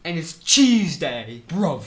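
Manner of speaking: put-on slow, loud British-accented mascot; Kool-Aid Man. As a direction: announce it in drawn-out mock British. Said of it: "The Kool-Aid Man's, like, terrifying".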